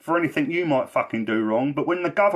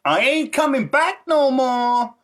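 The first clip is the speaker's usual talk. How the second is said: much higher, louder, slow put-on American accent; Yvonne's American voice; 13 semitones higher, 5 dB louder, much slower than usual. Recalling ex-girlfriend's dream visit, high loud slow American accent. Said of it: "in her American voice".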